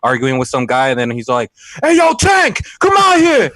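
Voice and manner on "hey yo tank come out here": loud high shout across a yard; a friend hollering from outside; calling out. Retold or imitated. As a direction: yell for Tank to come out.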